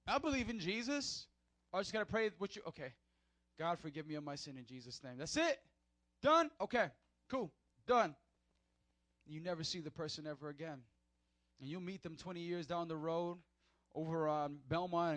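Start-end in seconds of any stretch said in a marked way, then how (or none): none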